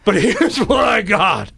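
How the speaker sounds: slow, high gravelly impression; New Rock Johnny D. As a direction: draw it out in a raspy buddy voice. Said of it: "he had this real gravelly voice".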